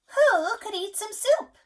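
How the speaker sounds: high, loud, slow hen asking; little red hen. A narrator's reading. Parent reading to child, hen calls all to eat. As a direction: call everyone to eat like the hen. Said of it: "says the little red hen".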